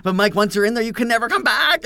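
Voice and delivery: high, loud mock-ominous shout; shouted warning. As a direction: yell it over a roaring vacuum. Teasing brother over roaring vacuum.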